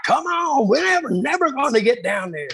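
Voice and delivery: high, pushy impatient hurry-up voice; impatient Savio. Retold or imitated. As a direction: hurry her down the stairs, impatient. Savio hurrying the mother downstairs.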